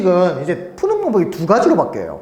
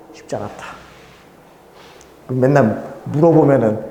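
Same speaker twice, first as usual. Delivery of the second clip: usual speaking voice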